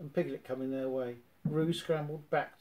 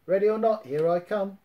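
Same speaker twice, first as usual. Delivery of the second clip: loud, higher called-out yell; Tigger yelling; 6 semitones higher, 9 dB louder than usual. A narrator's reading. Tigger calls out to the hiding Roo, yelling. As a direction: shout it across the woods. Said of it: "Tigger yelled".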